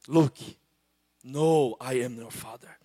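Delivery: a hint of the deep villain line; Darth Vader. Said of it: "Existem vozes Darth Vader". (um leve Darth Vader, mais grave)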